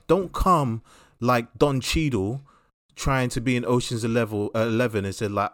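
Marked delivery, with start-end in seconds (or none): none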